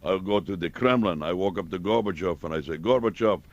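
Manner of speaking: a hint of a Russian deadpanning; Russian accent. Russian punchline voiced.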